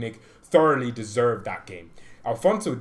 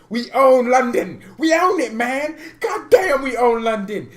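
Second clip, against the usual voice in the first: much higher and louder, triumphant; gloating shout; 10 semitones higher, 8 dB louder than usual. Real, not acted. Bayern fan crowing over the Chelsea win, loud gloating. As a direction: crow it over the city like you just took it.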